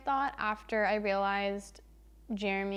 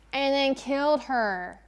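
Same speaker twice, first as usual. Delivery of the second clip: higher, slower, louder dramatic punchline; ominous; 4 semitones higher, 5 dB louder, slower than usual. Pretend. Vlogger reveals the murder in a hammed-up ominous voice. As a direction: drop it like a horror reveal.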